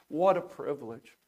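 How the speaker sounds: ordinary delivery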